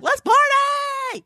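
high, slow, drawn-out party cheer. Retold or imitated. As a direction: stretch it out like she's kicking off a party. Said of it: "And I'm just like".

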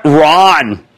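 normal talking voice

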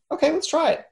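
high excited "okay"; chirpy eager yes; excited agreement. Retold or imitated. Quoting own agreement.

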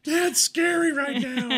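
high, drawn-out mock wail of a frightened child; scared kids. Dad imitating his kids' reaction to his anger, mock scared wail. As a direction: wail it slowly like an exaggerated little kid. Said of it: "My kids are like".

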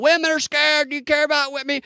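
very high mock heckler's cry; mock shouting. Host imitates heckler shouting at Doctor Oz, mock shouting. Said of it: "she just starts shouting out".

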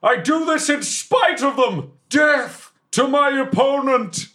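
very high, slow, defiant war cry; yelled battle cry. Acted. Warrior vowing before the crowd, slow high yell. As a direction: roar it to the heavens as a vow. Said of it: "And I yell".

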